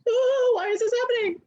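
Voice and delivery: very high, whiny mock cry; mock wailing. Open emotional center swamped by feelings, hammed-up wail. Mocking the overwhelmed reaction she avoids.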